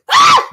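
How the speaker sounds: sudden loud high yelp; startled scream. Real, not acted. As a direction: yelp as if the chair is tipping. Startled while nearly falling from chair.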